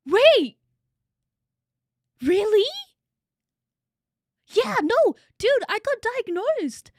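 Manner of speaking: loud, high-pitched disbelief; astonished. Real, not acted. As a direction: burst out in amazed recognition. Astonished at a shared diagnosis.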